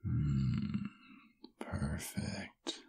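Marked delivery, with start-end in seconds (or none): 1.6-2.8 very soft, whispered approval; savoring whisper. Acted. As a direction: breathe it out under your voice, relishing it. Savoring her scent up close.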